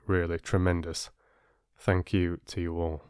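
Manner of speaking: plain reading voice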